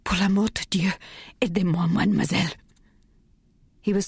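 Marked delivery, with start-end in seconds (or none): 0.0-2.5 urgent French plea, quick at the end; pleading in French. A narrator's reading. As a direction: beg for help in French, hurried. Sacha begs for help in French.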